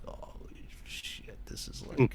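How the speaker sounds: slow hushed whisper of awe; awed whisper. Real, not acted. Fan stunned inside the Beatles' room, whispering in awe. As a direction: breathe it out in wonder. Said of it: "it just definitely felt like".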